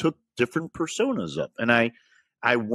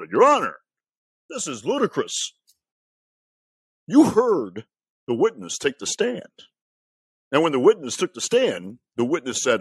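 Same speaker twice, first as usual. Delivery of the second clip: courtroom lawyer voice. Acted. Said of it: "This is my courtroom voice".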